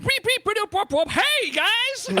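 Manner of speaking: high, loud goofy Cajun put-on; clueless attempt at a Cajun voice; mock Cajun accent. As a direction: ham up a bad Cajun accent. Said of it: "somebody give me an accent".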